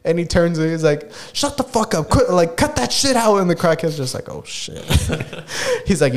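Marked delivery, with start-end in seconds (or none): 1.3-3.4 high-pitched mock tirade; mock crazy outburst. TikTok guy turning on a crackhead, mock crazy outburst. 4.3-4.8 voiceless, very soft mock alarm; cowed reply. Crackhead startled by the crazy guy, cowed reply.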